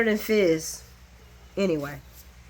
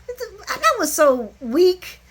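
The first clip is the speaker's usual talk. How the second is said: scornful drawl; 9 semitones higher, 5 dB louder, much slower than usual. Real, not acted. Mocking a weak threat.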